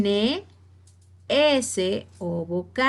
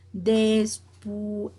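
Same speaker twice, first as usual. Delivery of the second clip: Después split into slow separate syllables, final "és" held; teacher's drawn-out Después, ending on a long "és". Shown as a demonstration. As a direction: sound out Después slowly, stretching the last syllable. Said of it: "la sílaba más fuerte".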